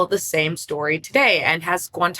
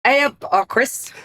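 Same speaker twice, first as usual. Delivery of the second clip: a clumsy, hammed-up northern English "hey up"; bad northern British accent; 6 semitones higher than usual. Cohost obliging the scripted greeting, a bad northern British accent. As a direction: put on a mock northern British accent, badly. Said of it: "That might be the worst British accent".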